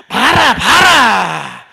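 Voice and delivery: slow, loud drawn-out Gyeongsang call; Gyeongsang dialect. Retold or imitated. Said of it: "아마 저 이랬을 것입니다". (경상도 사투리로 길게 외치는 소리)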